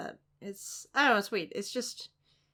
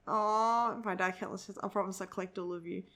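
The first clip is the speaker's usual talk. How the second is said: usual speaking voice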